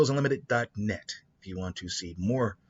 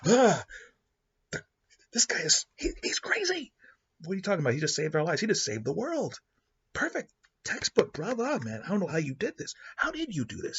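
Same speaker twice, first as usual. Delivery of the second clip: high astonished telepath; 10 semitones higher than usual. Retold or imitated. Telepath reading his mind, high and awestruck. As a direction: squeal like a stunned mind reader.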